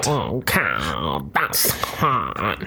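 low, slow mooing voice; deep cow voice. Pretend. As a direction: rumble it like a cow. Joking voice for the cow photo.

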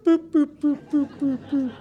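high electronic beeps, repeated; high boops. Retold or imitated. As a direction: mimic a map's blinking lights, high. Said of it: "red lights going boop".

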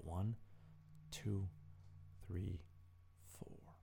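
soft, counted breathing guide; guided breathing. Acted. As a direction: count the breaths softly and calmly. Leads breathing exercise.

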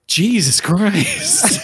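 very slow, high and laughing; drawn out disbelief; stunned dad. Retold or imitated. Father reacting to horror.

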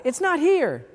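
mild mock yell, pitched high; high, half-hearted holler; retold yell back. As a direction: imitate calling back from a failed search. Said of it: "we would yell back".

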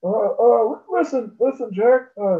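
put-on halting old politician parody; Joe Biden impression. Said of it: "Can you do, uh, Joe Biden?".

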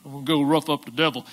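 a quick, tough-guy Rocky voice; Rocky Balboa impression. Pastor doing Rocky as Peter the rock, quick tough guy. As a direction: talk like a boxer itching to fight. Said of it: "like Rocky Balboa".

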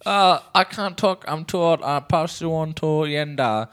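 a hint of a frail old man; old man caller. Prank caller playing tired old Bing Ree.

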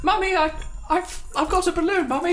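put-on high, eager small child; little child voice. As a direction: chirp it like a toddler. Child voice in sketch.

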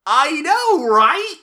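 high, loud and thrilled; excited disbelief. Real, not acted. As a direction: burst out with disbelief. Shocked reaction to big news.

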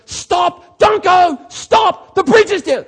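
high, loud, frantic shouting; desperate loud warning to drivers on the road; urgent shouted warning. Shown as a demonstration. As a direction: yell it to stop someone from driving off the edge. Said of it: "Same words, but said differently".

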